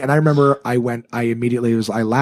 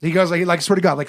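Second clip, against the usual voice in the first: ordinary talk, nothing marked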